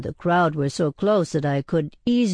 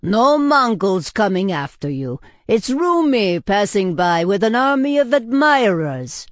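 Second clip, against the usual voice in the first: loud, raised-pitch shout across a tavern; yelling; 5 semitones higher, 5 dB louder than usual. A narrator's reading. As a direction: call the news out over the crowd. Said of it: "yelled Christos, the tavern owner".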